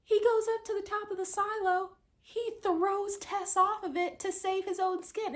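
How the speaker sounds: high, loud, indignant telling; outraged at the betrayal; outraged retelling. Real, not acted.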